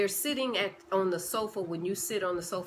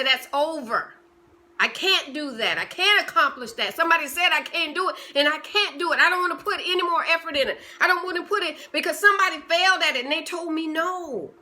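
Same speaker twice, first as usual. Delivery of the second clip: louder, higher and faster excuses; defeated protest; 6 semitones higher, 7 dB louder, faster than usual. Pretend. You talking yourself out of your goals, loud and worked up.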